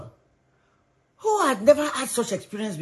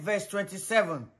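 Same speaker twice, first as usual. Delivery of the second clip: ordinary delivery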